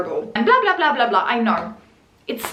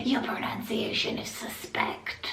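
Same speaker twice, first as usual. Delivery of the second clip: hushed, whispery comment voice; conspiratorial hush; mock suspicion; 3 semitones lower, 12 dB quieter than usual. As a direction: drop to a near-whisper.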